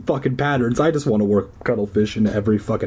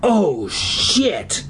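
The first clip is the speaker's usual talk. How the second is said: high startled cry; shocked gasping cry; cried out; 6 semitones higher than usual. A narrator's reading. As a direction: cry out in dismay. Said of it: "she cried".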